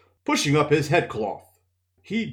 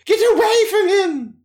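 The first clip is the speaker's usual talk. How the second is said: much higher, louder commanding cry; sharp shout; 8 dB louder than usual. Acted. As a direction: bark the order urgently. Moiraine orders Nynaeve away.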